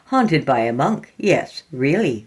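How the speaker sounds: usual speaking voice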